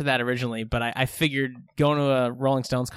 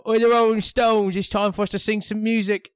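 hammed-up voice from the stage; concert stage voice; 9 semitones higher, 6 dB louder than usual. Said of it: "you hear a voice go".

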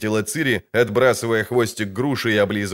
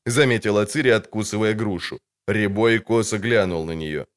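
ordinary talk

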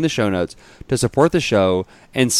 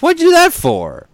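high, loud tiny snail voice; 7 dB louder than usual. Retold or imitated. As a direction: squeak it like an offended snail.

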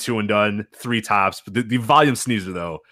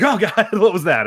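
laughing; 6 semitones higher, 3 dB louder than usual. Retold or imitated. Startled coworkers at loud sneeze.

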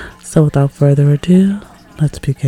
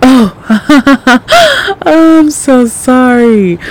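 flustered laugh, higher and louder; embarrassed laugh; 7 semitones higher, 7 dB louder, slower than usual. Acted. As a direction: laugh it off, mortified.

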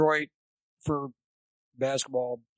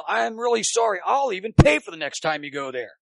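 higher, louder hammed-up apology; mock yelled apology; 4 semitones higher, 5 dB louder than usual. Umpire apologizing to coach while trying not to laugh, mock yell. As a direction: act out a heated, joking apology.

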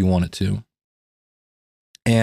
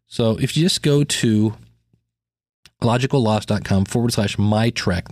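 normal talking voice

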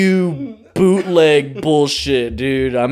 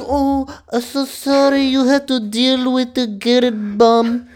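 mock sympathy; 10 semitones higher than usual. Mocking Lana's sympathy.

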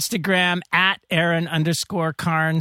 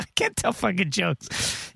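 ordinary delivery

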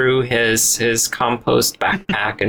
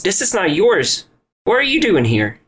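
higher-pitched flustered protest; 8 semitones higher than usual. Acted. Robert confronting an intruder in his motel room, flustered. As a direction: play him flustered, voice jumping up. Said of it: "Robert just gets flustered".

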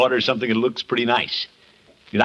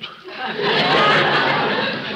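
short breathy laugh, pitched up; amused laugh. Acted. To Peavy after his joke, an amused laugh. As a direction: laugh off the teasing.

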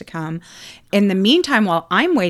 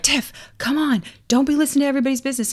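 imitating a nagging husband, higher pitched; mock chiding; 7 semitones higher, much faster than usual. Husband to nosy wife in hotel hallway, chiding. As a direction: hurry her along in a higher, quick mock scold. Scolding husband voiced in story.

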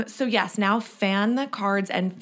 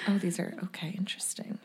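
whispering; 9 dB quieter than usual. Real, not acted. Reading cards in hushed tone.

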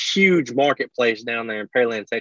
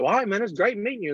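higher and fast wrap-up; lightly voiced quick farewell, pitched up; mock polite goodbye; 7 semitones higher, 4 dB quieter, faster than usual. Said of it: "I'll be like".

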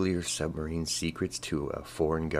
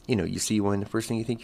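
ordinary talk

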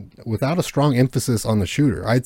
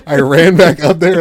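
excited; 8 semitones higher, 9 dB louder than usual. Real, not acted. Excited retelling of shooting kid.

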